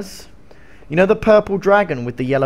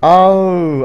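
ordinary talk

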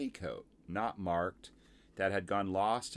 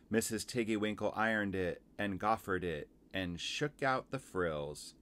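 usual speaking voice

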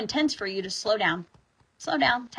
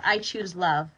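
ordinary talk

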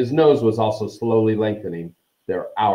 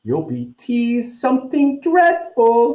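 high, slow, gloating singsong; gleeful high-voiced ghost girl; happy; 4 dB louder, slower than usual. A narrator's reading. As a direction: taunt with drawn-out delight, way up in pitch. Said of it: "said Myrtle happily".

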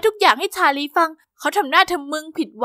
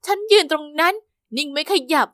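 ordinary delivery

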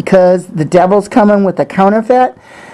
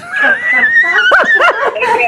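shrieking laugh. Real, not acted. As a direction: let the joy explode into a shriek of laughter. Overjoyed, laughing fit.

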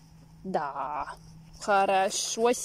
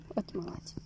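soft whispered praise; whispering; 4 semitones lower, 7 dB quieter, faster than usual. Real, not acted. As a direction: breathe the praise gently. Praising the dog softly.

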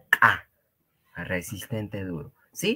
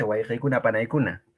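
ordinary delivery

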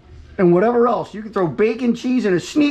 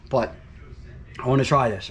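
ordinary talk, nothing marked

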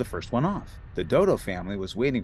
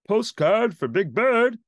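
high, louder slow post bird call; 10 semitones higher, 7 dB louder, slower than usual. A narrator's reading. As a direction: call through the door. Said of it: "called the post bird".

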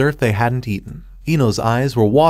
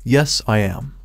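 ordinary delivery, nothing marked

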